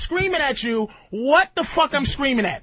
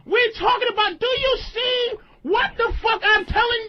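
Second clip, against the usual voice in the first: emotional outburst; 8 semitones higher than usual. Real, not acted. Host yelling at a combative guest, real anger. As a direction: blow up in real frustration. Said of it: "He sounds emotional".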